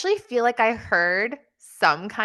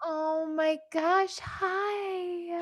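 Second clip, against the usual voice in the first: cooing at puppy; 7 semitones higher, 7 dB quieter, much slower than usual. Real, not acted. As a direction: melt over a puppy, slowly and softly. Greeting the puppy again.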